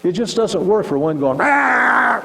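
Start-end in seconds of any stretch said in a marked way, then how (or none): 1.4-2.2 loud, high-pitched mock roar; angry roar. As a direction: imitate an arguer roaring wordlessly. Acting out one shouting arguer.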